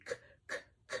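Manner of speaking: "K, k, k" as bare voiceless clicks; K sound demo. Shown as a demonstration. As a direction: sound out "K, k, k" with no vowel. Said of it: "That's the k sound".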